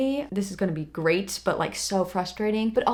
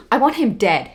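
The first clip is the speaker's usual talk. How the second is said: usual speaking voice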